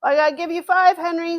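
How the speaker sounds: ordinary talk, nothing marked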